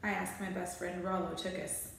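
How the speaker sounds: ordinary delivery, nothing marked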